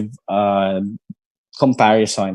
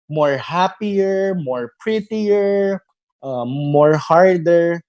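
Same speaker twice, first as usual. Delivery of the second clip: drawn-out, higher demo of common mistakes; mock wrong English; 5 semitones higher, much slower than usual. Shown as a demonstration. Teacher to students showing a common error, slow and mock. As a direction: stretch each wrong phrase slowly like a bad example. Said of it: "people are saying".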